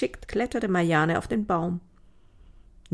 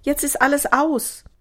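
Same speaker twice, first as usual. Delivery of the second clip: high, loud dismay; horrified; 8 semitones higher, 5 dB louder than usual. A narrator's reading. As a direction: cry out as if all is lost. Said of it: "Sie biss sich auf die Lippen". (entsetzt, mit hoher, lauter Stimme)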